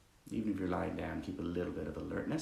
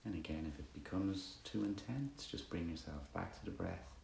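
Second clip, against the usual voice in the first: soft, unhurried meditation guidance; guided meditation; 11 dB quieter than usual. Acted. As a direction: guide them gently through body and breath, pausing between phrases.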